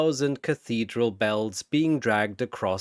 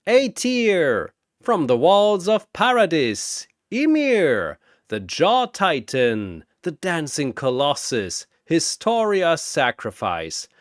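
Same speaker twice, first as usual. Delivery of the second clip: booming announcer; 4 semitones higher than usual. Acted. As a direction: boom it across the arena. Announcer introduces next fighter.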